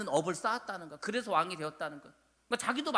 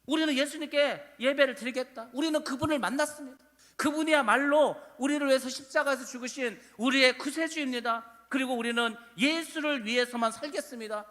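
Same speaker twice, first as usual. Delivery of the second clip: put-on higher-pitched declaration of faith, quoting the young believers; retold bold confession; 5 semitones higher, 4 dB louder than usual. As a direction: confess it firmly, as the accused youths would. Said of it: "하나같이 다 예수를 시인하는".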